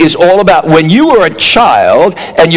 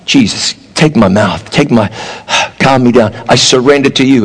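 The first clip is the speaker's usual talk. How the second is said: calming prayer; 6 semitones lower than usual. Retold or imitated. Voiced prayer to calm down.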